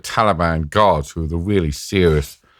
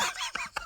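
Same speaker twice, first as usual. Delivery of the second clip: breathy laugh; 19 dB quieter than usual. Real, not acted. Laughing in the toilet.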